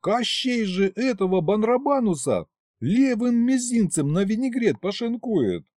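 high-pitched disbelieving protest; high, mildly indignant objection; incredulous. A narrator's reading. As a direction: insist it simply can't be true.

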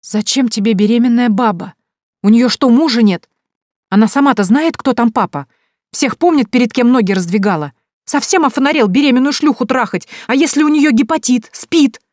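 high, loud, mounting outrage; furious tirade. A narrator's reading. Mother berating son over pregnant woman, furious.

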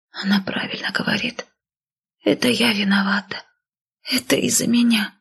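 put-on whispery, frail sick girl; hushed, feeble confession; quiet croak. A narrator's reading. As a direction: take the blame in a weak, breathy voice. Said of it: "тихо прохрипела с кровати Эмбер".

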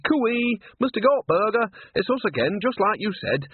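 high-pitched sing-out greeting; calling out cheerily. Acted. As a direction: trill a cooee through the doorway. Calling out on opening the door.

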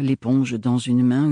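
usual speaking voice

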